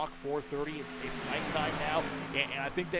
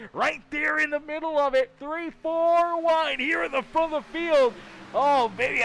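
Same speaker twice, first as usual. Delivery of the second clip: booming thrilled race call; excited call; 11 semitones higher, 10 dB louder than usual. Acted. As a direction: sell the near-wreck to the fans. Four wide near-crash on track.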